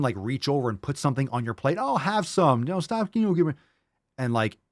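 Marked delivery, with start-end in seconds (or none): 1.8-3.5 higher mock-coaxing voice; high-pitched mimicry of insisting; pushy relative. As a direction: imitate a relative pressing food on someone. Acts relative forcing food.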